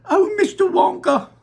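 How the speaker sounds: high, loud plea; the mother wails to Wonka for help; wailing. Acted. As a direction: wail to him in despair. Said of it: "wailed Missus T V".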